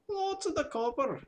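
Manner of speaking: hammed-up half-Welsh voice; vague Welsh accent. Shown as a demonstration. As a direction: put on a rough Welsh accent. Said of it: "Not quite sure what accent that is".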